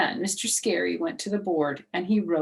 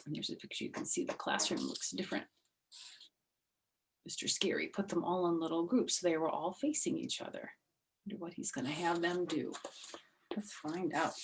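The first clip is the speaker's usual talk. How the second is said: hushed read-aloud aside; soft aside to young listeners; story aside; 10 dB quieter, faster than usual. A narrator's reading. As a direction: lean in and share a secret with the listener. Aside to listener about picture.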